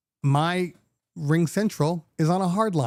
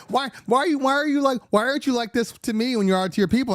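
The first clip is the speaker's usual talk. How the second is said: wife complaining; 9 semitones higher, faster than usual. Retold or imitated. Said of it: "She's like".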